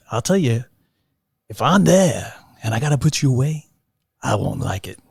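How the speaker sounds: tough, intense gangster talk; movie scene reenactment. Retold or imitated. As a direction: play it like a hard-nosed cop. Reenacting De Niro/Pacino diner scene.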